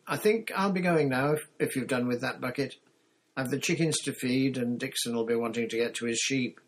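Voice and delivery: homely farmer's wife with chores waiting; busy farmer's wife. Acted. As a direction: take your leave as a busy farm woman. Voices Missus Dixon.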